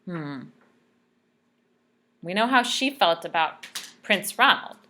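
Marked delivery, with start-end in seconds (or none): none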